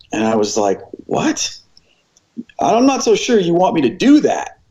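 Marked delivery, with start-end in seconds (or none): 1.1-4.6 high-pitched startled what, then doubtful protest; retold disbelief, pitched well up; incredulous. Preacher balking at the sermon assignment, incredulous.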